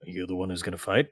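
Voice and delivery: subdued, reserved tavern friend; sceptical friend. Acted. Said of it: "He's like".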